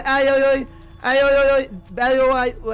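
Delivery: rising panicked ay ay ay; panicked yelping. Real, not acted. Panicked game moment.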